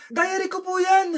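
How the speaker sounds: normal talking voice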